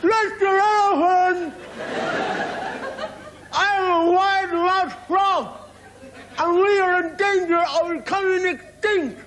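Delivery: very high, slow, stretched frog cry; wide-mouthed frog calling. Acted. Frog calling up to the big elephant, high and drawn out. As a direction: call up to a giant from far below.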